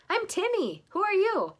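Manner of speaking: put-on high, bright little boy voice. Timmy the little boy greeting Roger, high-pitched. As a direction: speak as a small excited boy.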